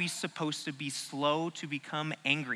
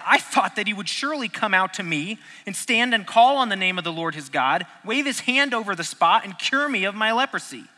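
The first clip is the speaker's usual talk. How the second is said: raised, louder indignant reading; higher, louder outraged complaint; angry Naaman; 4 semitones higher, 4 dB louder than usual. Acted. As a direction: read it like an offended general. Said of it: "Naaman went away angry".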